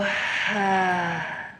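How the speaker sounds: long open-mouthed sigh out; audible sigh. Shown as a demonstration. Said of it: "And let it go".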